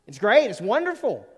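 It's great shouted up high and loud. Shown as a demonstration. Preacher exulting to the congregation, loud and high. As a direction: hit it again with full preaching joy.

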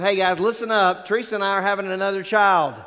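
loud, quick mock big news; mock announcement. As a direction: pretend to grab the room with news. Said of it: "like me saying".

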